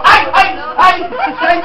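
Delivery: loud, high cries of alarm; mock cry. Ham crying out at the sight of his father, in loud mock alarm.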